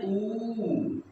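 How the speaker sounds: ऊ stretched out slowly as a long vowel; long drawn ऊ. Shown as a demonstration. Teacher to children, showing the long ऊ slowly drawn out. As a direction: hold the ऊ long and slow. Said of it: "होता है बड़ा उ".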